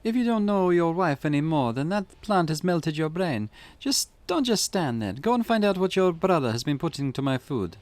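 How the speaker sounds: put-on exasperated Eastern European accent; scolding Eastern European accent. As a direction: tell him off in a mock Eastern European accent. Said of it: "my Eastern European accent".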